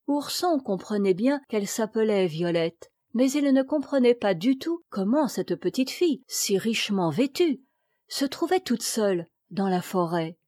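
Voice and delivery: plain reading voice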